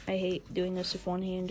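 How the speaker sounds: ordinary talk, nothing marked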